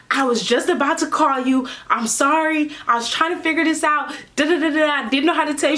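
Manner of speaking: imitated high, apologetic friend voice. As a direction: plead innocence as the cornered friend.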